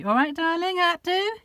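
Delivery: very high, tender coo to the cat; pet talk. Real, not acted. Praising her cat.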